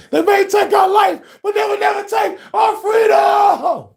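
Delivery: high, loud hammed-up war shout; mock Braveheart rallying shout; Braveheart battle cry. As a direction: roar it like a rebel leader before battle. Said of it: "Braveheart this bitch".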